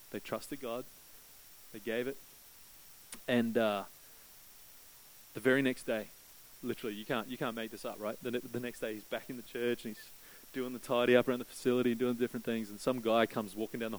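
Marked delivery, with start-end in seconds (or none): none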